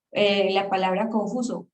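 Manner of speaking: usual speaking voice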